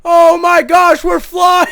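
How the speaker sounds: high, loud cries of amazement; astonished shouting. Real, not acted. Gamer launched skyward by their own cannon, astonished shouting. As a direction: shout it in thrilled disbelief as it takes off.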